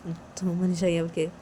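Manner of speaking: usual speaking voice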